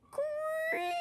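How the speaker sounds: high, soft imitated creak; spinning wheel creak. A narrator's reading. Spinning wheel moving in the dark, high creak. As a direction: squeal it like an old wheel. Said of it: "went the wheel".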